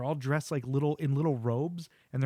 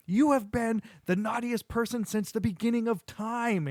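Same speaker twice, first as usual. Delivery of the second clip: raised-pitch mock scolding; indignant Santa; 8 semitones higher than usual. Santa telling off the devil, mock indignant. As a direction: lay down the accusation with acted indignation.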